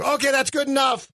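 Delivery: high, mock director's shout; calling out. Director stopping the actor's audition, calling out. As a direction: cut the audition off abruptly, pitch jumping up.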